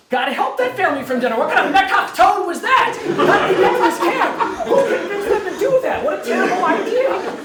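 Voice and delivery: laughing at the family from Denver, mock outrage. Real, not acted. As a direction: cry out in amused disbelief.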